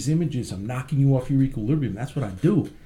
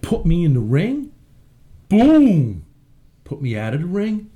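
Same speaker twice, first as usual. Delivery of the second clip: usual speaking voice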